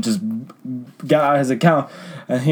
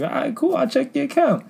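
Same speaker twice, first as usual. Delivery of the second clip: much higher, sugary agent voice; fake-polite service voice; 3 dB quieter than usual. Agent humoring a demanding customer, high fake-sweet voice. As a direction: sweeten it like a call-center script.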